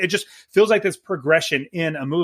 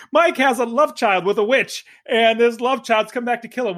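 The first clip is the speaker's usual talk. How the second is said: laughing, higher-pitched plot summary; amused disbelief; 6 semitones higher than usual. Real, not acted. Co-host asking about the absurd plot, amused disbelief. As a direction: say it through amusement, voice lifted.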